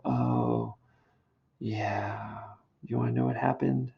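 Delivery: soft, reluctant mock sadness; feigned gloom. Nurse teasing Juliet with news, pretending sadness. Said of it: "She's gonna pretend that, uh, you know, everything is so sad and terrible".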